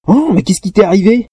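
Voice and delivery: high, startled outcry; shocked. A narrator's reading. One girl shocked at the other's messy hair, startled. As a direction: gasp it out in dismay.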